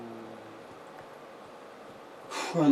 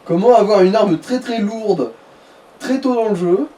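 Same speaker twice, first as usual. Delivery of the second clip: mock tutorial voice; 5 semitones higher, 5 dB louder, slower than usual. Mock tutorial title to viewers.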